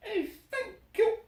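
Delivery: very high, teary-bright chirp; chirping bird. Acted. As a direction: squeak out delighted thanks.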